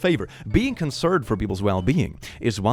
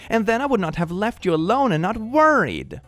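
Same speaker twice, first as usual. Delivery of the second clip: mom voice; 9 semitones higher than usual. Retold or imitated. Reading mom's text.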